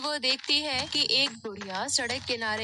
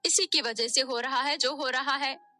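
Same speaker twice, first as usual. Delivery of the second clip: higher, louder blaming voices; accusing quarrel; 6 semitones higher, 4 dB louder than usual. Acted. Sister blames Nisha for father's death, accusing. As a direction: throw the blame hard.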